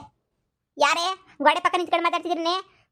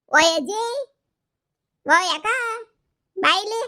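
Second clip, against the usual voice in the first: loud sing-song call to grandma from hiding; teasing call out; 6 semitones higher, 3 dB louder than usual. Acted. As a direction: call her out from behind the wall. Hidden prankster calling grandma.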